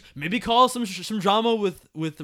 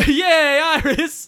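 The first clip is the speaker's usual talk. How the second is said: sarcastic cheer; 11 semitones higher, 9 dB louder, much slower than usual. Pretend. Mocking cheer for Iris.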